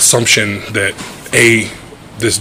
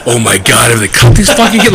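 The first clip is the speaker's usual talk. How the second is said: laughing; 3 semitones higher than usual. Real, not acted. Laughing at own obsession.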